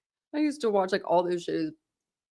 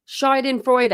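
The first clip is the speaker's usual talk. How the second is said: Schadenfreude in a put-on accent, higher pitched; put-on foreign accent; 6 semitones higher, 5 dB louder, slower than usual. Real, not acted. Reader copying how it's pronounced, Schadenfreude in a put-on accent. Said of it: "I don't know why I put the accent on there".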